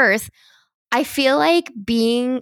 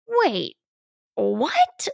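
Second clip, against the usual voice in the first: stunned disbelief; 3 semitones higher than usual. Retold or imitated. Her stunned reaction retold.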